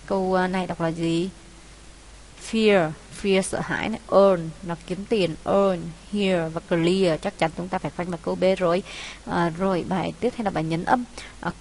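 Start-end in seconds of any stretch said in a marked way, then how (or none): none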